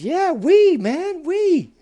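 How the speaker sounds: loud, high-pitched insistence; emphatic bullfrog. Acted. Bullfrog answering a doubting boy, loudly emphatic. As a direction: exclaim it as an insistent story bullfrog.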